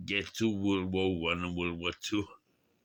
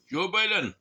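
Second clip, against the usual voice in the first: high, loud call that falls away; sudden loud high exclamation; exclaimed; 11 semitones higher, 7 dB louder than usual. Real, not acted. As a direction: call the name out, pitched way up. Exclaims the name a second time.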